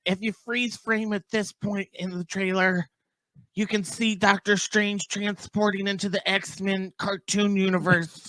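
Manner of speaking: high, slow, drawn-out parody of an overexcited YouTuber; mocking trailer breakdowns. Imitating YouTubers who drive him nuts, mock revelation. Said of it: "everybody on YouTube is, is freeze framing".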